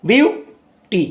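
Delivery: "Beauty" split slowly, "বিউ" high and stressed; stress on first syllable. Shown as a demonstration. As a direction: punch "বিউ" in "beauty", pause, then a separate "টি". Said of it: "টি এর ওপর নয়". ("বিউটি"-র "বিউ"-তে জোর, টেনে আলাদা করে)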